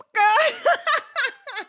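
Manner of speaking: shrieking burst of laughter; loud high laugh. Real, not acted. Podcaster cracks up at her own outburst, laughing loudly.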